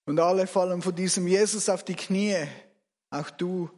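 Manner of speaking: ordinary delivery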